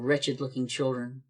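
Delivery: ordinary talk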